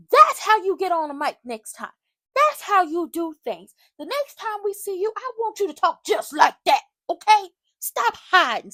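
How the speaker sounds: loud, high coaching rant; addressing Shiloh, loud and pushing; hyped-up scolding. Real, not acted. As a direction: fire him up and push him.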